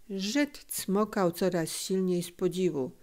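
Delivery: plain reading voice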